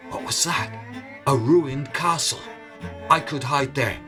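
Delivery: ordinary delivery, nothing marked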